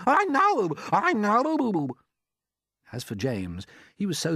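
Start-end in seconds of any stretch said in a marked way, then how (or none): 0.0-2.0 a much higher, louder put-on aunt's voice, excited; Aunt Sponge. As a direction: play the greedy aunt gaping at the peach.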